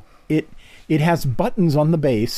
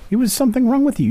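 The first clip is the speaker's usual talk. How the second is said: higher, faster mock outrage; mock scandalised; 11 semitones higher, 3 dB louder, faster than usual. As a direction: parrot the old prejudice in a shocked voice. Mock-scandalised repeat of social judgement.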